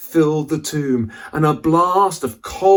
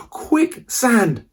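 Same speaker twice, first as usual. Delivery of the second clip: urgent warning; 11 semitones higher than usual. Acted. Warning of quicksand.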